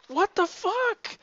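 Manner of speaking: high, slow, drawn-out disbelief; a sudden incredulous squeal, stretched out; stunned what the. Retold or imitated. Reading the comic, he reacts in high, stretched-out disbelief.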